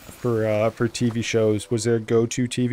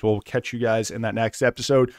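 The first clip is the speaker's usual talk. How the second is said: ordinary talk, nothing marked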